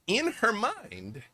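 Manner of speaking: a high, slow, dreamy rise; eerie lilt. A narrator's reading. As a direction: sing it out like a voice inside her head. GM describes the NPC's thoughts.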